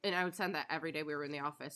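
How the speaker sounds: normal talking voice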